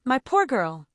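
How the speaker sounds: ordinary delivery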